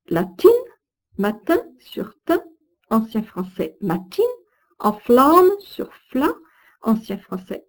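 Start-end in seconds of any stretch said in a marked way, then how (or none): 1.2-2.4 "matin" with "tin" lifted high; strongly marked stress. Shown as a demonstration. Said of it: "accent tonique fortement marqué". 4.8-6.3 "enflamme" with "fla" raised and slow; strongly marked stress. Shown as a demonstration. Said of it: "accent tonique fortement marqué".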